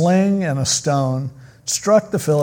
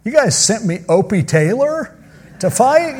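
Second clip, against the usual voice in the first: higher, rising scoff; mocking disbelief; 7 semitones higher than usual. Goliath scoffing at Israel, mock high scoff. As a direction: sneer at the boy they sent. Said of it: "So he's like".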